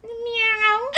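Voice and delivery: high-pitched meow; cat meow. Pretend. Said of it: "making animal noises".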